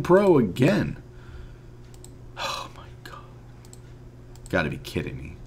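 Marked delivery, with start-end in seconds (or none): 2.4-3.2 defeated breathy whisper of disbelief; barely voiced, crushed; whispered dismay at yet another bad pull. Real, not acted. Another bad pack pulled. 4.5-5.4 soft, low, defeated mutter; all the fight drained out, real letdown; quiet, crushed disbelief at a bad pack; deflated. Real, not acted. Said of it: "I am so depressed right now".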